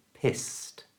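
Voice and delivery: Pissed said quietly, ending in a crisp t sound; Pissed, final ed as t. Shown as a demonstration. Teacher models pissed ending in t. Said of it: "pronounced as a T sound".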